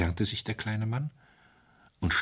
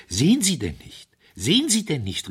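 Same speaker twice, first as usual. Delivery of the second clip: high, loud, urgent repeat; feverish cry; 10 semitones higher, 6 dB louder than usual. A narrator's reading. Wilfrid urges the doctor to see it, feverish and loud. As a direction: press it on them, almost frantic. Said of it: "rief er fieberhaft".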